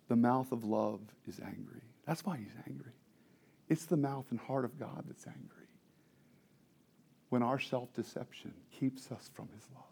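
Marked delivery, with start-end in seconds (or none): none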